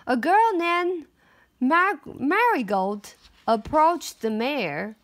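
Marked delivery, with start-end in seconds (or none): none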